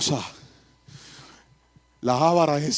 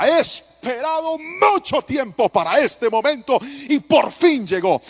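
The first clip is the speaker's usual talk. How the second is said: slow, loud and higher; passionate declaration; 9 semitones higher, 5 dB louder, slower than usual. Retold or imitated. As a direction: groom tells his wife he has waited long, loud and slow. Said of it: "Y dije".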